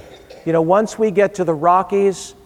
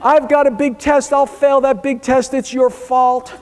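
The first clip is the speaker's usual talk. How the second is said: put-on crying teen; 10 semitones higher, 3 dB louder than usual. Dad imitates daughter blaming parents, high mock sob. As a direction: blame the parents through mock tears. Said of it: "And she starts crying".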